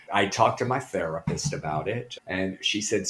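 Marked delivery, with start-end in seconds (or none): none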